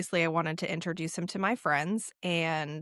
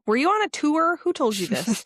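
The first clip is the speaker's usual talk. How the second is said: high, loud disbelieving question; can't believe what she's hearing; incredulous; 7 semitones higher, 4 dB louder than usual. Real, not acted. Friend doubting a word, incredulous high question.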